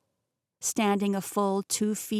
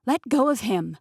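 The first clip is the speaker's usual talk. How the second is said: louder, higher command; demanding shout; 4 semitones higher, 6 dB louder than usual. Acted. Heroine yelling at Peter to release Tate, loud shout. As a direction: snap it out to free him.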